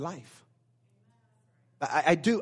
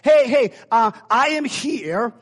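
loud, high, drawn-out announcement; calling for attention; 7 semitones higher, 8 dB louder, slower than usual. Pretend. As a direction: call out to get a group's attention. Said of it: "If, if I was saying".

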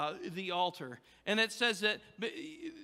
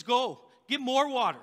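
raised, louder order; acted command; 5 semitones higher, 7 dB louder than usual. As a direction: send them off with a firm order. Acts Elijah ordering more water.

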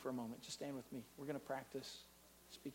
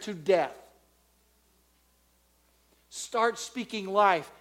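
loud, rising preacher's call; fiery preaching; 8 semitones higher, 17 dB louder, slower than usual. Acted. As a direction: preach it hard to the whole church. Preaching at full force.

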